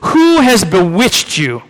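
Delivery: quoting Paul with mild dismay; exasperated rebuke. Retold or imitated. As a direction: scold the Galatians like a grieved apostle. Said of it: "And he says".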